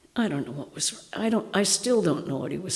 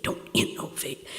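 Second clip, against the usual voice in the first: whispered repeat; 5 dB quieter than usual. Retold or imitated. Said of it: "he said".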